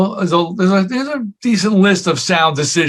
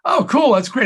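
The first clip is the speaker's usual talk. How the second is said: excited; 10 semitones higher than usual. Retold or imitated. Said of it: "I'm like".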